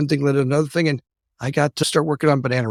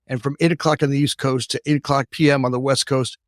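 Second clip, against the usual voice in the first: usual speaking voice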